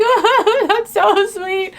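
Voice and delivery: replying to a follower's message, sweetly gushing. Retold or imitated. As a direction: coo it like you're touched. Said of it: "I'm like".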